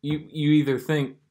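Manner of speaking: usual speaking voice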